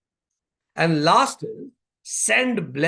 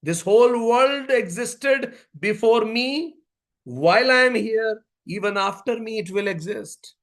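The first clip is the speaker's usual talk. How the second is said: ordinary delivery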